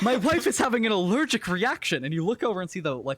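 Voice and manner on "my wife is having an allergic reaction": high, raised, distressed voice; alarmed protest. Acted. Husband explaining his wife's condition to attackers, high alarmed protest. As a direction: plead loudly for your stricken wife. Man protests on behalf of wife.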